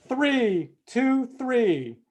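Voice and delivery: slow dramatic announcement. Real, not acted. Announcing the winning number.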